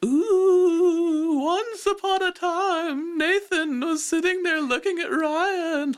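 high, slow, eerie ghost wail; Mother Goose ghost voice. Pretend. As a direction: croon it like a storytelling ghost. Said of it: "my ghost of Mother Goose voice".

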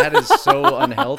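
high, loud, laughing mock outrage; mock shocked squeal. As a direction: squeal it in fake horror. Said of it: "And I'm like".

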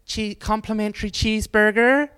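chirpy imitation of the lady offering cheeseburgers; lightly voiced cheerful server; lovely lady offering food. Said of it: "She's like".

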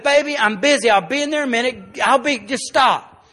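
frazzled dad losing patience; stressed, impatient. Retold or imitated. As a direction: brush the child off loudly. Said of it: "I was tired, and I was stressed".

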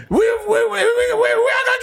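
high, stuttering Jamaican club shout; hammed-up Jamaican DJ yell; Jamaican hype man. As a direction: stutter into a long dancehall holler, Jamaican accent.

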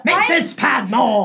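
slow, drawn-out mock British servant cry; character impression. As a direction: call it out like a period-drama cook. Calls out as Downton cook character.

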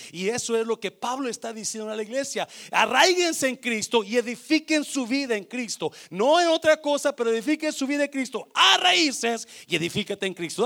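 loud, high, driving sermon cry; fervent pulpit call; fiery preaching. Acted. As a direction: preach it at full force to the church. Preacher exhorting church.

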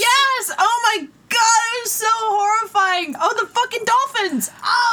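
very high-pitched, excited squealing; horrified outburst. Real, not acted. Co-host recalls horrifying animal photos, excited horror. Horrified at remembered dolphin pictures.

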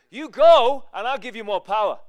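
loud, high order, easing into a promise; commanding. Acted. Jesus answering the bargaining believer, loud command. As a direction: send them out with a forceful first word.

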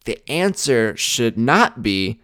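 ordinary talk

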